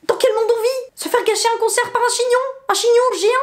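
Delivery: high, indignant cry over the bun; exasperated at the giant bun; outraged disbelief. Retold or imitated. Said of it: "je me suis dit". (indignation aiguë et exaspérée)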